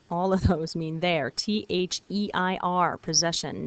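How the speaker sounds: normal talking voice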